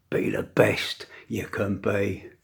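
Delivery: hushed whisper, slow. Real, not acted. To the men watching, a slow closing whisper. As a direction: whisper it as a parting charge.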